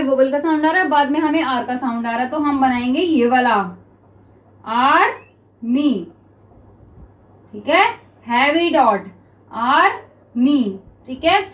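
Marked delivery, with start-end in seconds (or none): 4.7-6.1 slow army, split as "आर - मी"; syllable by syllable. Shown as a demonstration. Word broken up while writing it. 9.5-10.8 slow army, split as "आर - मी"; syllable by syllable. Shown as a demonstration. Word broken up while writing it.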